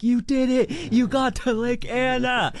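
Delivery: high, loud, cheering praise; imitating cheering campers. Camp crowd greeting the returning hikers, high and loud cheering. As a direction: cheer them like heroes coming home. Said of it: "everybody's gonna be like".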